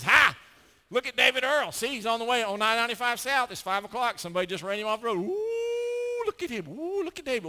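gloating, jeering accuser with cackles, high and loud; mocking Satan. Preacher voicing Satan accusing David before God, gleeful jeering. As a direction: taunt like a sneering devil.

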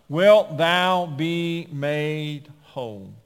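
slow, emphatic scripture quote; word by word. Acted. Preacher quotes Jesus's five-word question, slow and emphatic. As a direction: draw out each word, loud at first.

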